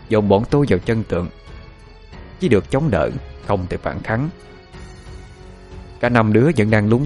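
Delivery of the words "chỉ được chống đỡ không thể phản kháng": plain reading voice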